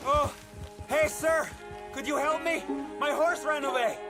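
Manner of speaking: high, urgent plea; calling for help. Acted. Woman whose horse ran away begs a man for help, calling out. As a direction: hail a stranger from a distance.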